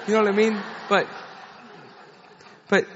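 normal talking voice

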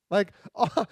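ordinary talk, nothing marked

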